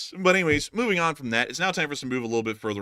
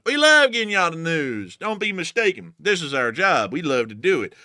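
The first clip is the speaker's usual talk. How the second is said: usual speaking voice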